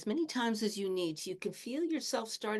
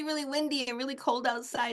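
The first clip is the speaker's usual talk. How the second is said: ordinary talk, nothing marked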